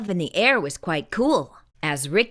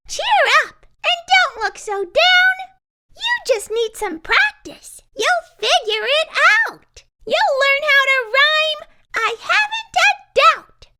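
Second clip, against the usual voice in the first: much higher, louder, cheery tin friend; bright Tess; 5 dB louder than usual. A narrator's reading.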